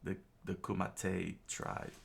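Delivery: plain reading voice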